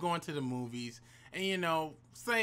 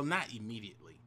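ordinary delivery

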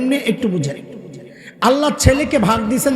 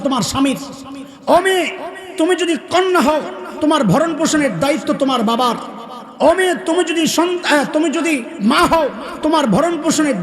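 higher, laughing rousing call; fervent preaching; 4 semitones higher than usual. Acted. Preaching refrain to women. (হাসিমাখা উচ্চস্বরে ওয়াজ)

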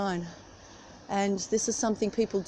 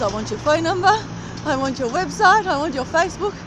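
eager stranger; 6 semitones higher, 5 dB louder than usual. Retold or imitated. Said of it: "He goes".

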